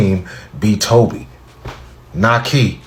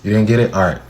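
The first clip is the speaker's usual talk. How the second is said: usual speaking voice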